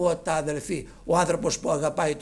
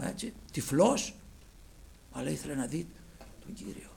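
ordinary talk

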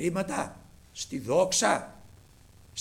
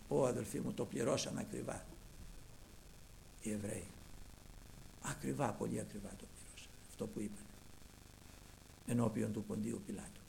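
low, soft, sinking voice; hushed lament; 7 semitones lower, 11 dB quieter than usual. Real, not acted. Preacher lamenting to brethren the dear price paid, hushed. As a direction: drop to a grave hush for the congregation.

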